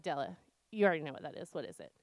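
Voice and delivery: quick, low and weary retort; retold exasperation, dropping low at the end; exasperated mom. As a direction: wearily hand the question back to a toddler. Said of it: "I'm irritated. I'm exasperated".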